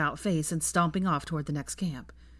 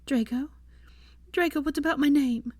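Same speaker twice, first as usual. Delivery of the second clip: high, worried questioning; rising dread; anxious urging; 8 semitones higher than usual. A narrator's reading. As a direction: urge him to answer, pitched high. Prompting her dying companion, dreading death.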